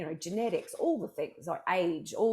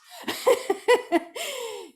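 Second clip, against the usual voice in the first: high-pitched burst of laughter; a big high cackle at her own joke; 7 dB louder than usual. Real, not acted. Laughing at her own joke to the host, high laugh.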